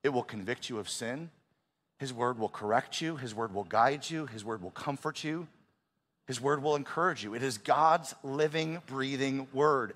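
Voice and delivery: hushed, slowing, earnest sermon cadence; soft intimate preaching. Acted. Pastor speaking softly to the congregation about God's word, hushed and earnest. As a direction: lay each line down gently, almost prayed.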